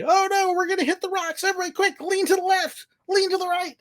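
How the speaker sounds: very high, hammed-up alarm; goofy mock panic. Skipper warning riders of fake mortal danger, goofy panic. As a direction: squeal urgent orders in pretend mortal danger. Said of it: "being really goofy".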